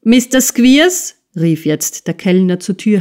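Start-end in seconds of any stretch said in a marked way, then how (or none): 0.0-1.1 called from the doorway, higher and louder; calling out. A narrator's reading. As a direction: call across the room to him. Waiter calls through door. (Kellner ruft zur Tür herein)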